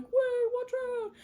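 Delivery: high-pitched mock alarm; alarmed warning. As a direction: call out a hammed-up warning in a high voice. Acting a warning across the ice.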